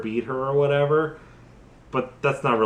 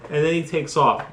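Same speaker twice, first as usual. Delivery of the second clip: ordinary talk, nothing marked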